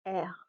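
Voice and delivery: R said softly as "air"; quiet French R, like air. Shown as a demonstration. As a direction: let R trail off quietly, unrolled. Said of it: "the R is soft".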